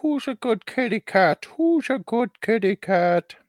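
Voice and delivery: put-on high cooing baby talk; pet talk. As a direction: coo it like to a pet.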